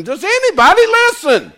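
teasing, high and loud complaint; mock exasperation. Preacher teasing a congregation that forgot the lesson, mock exasperated. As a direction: playfully scold the congregation. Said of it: "I'm just teasing".

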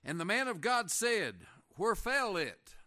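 preacher declaiming the verse slowly; slow scripture reading. Real, not acted. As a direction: read it out slowly and forcefully. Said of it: "I just want to read it slowly".

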